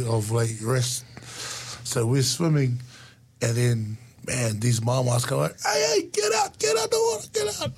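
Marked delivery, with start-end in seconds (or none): 5.7-7.7 high shouting call from the shore; retold shout. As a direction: yell them out of the water. Mamas yelling at swimming kids.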